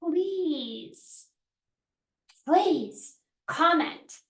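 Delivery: ordinary talk, nothing marked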